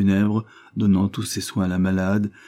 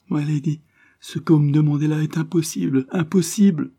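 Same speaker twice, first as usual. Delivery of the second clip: high-pitched, agitated protest, climbing at the end; distressed refusal; 7 semitones higher, 3 dB louder than usual. A narrator's reading. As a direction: refuse in anguish, voice raised high. Distressed maid refuses dying mistress. (refus affolé, d'une voix aiguë)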